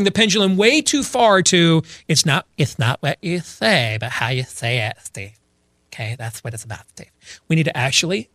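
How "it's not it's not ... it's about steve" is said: soft lisping effeminate caricature; mock effeminate man. Preacher parodying those who care only how it's said, effeminate lisp. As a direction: lisp it daintily, trailing off quieter. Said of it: "less effeminate".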